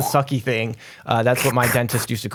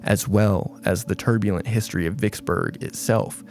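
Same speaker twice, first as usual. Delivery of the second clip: spooky read; 5 semitones lower, 4 dB quieter than usual. Acted. Reads ghost site text.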